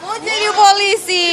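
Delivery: high, slow, drawn-out delighted echo; excited cheering. Real, not acted. Reporter to a child wanting to be police, excited cheering.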